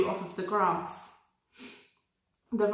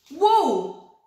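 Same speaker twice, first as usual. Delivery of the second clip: higher, louder single word; sheep voice; 7 semitones higher, 7 dB louder than usual. Acted. Sheep offering a rhyme, higher and louder. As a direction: call the rhyme out brightly. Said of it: "said a voice".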